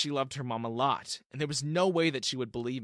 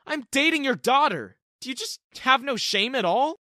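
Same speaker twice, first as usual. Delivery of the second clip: high, loud furious accusation; outraged yelling; 11 semitones higher, 9 dB louder than usual. Acted. Terrence shouting at his girlfriend's mom, furious. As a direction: shame her at full volume.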